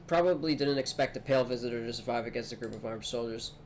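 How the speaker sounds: ordinary delivery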